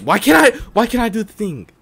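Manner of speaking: high, loud complaint; frustrated outburst. Real, not acted. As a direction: burst out in exasperation. Frustrated with the game.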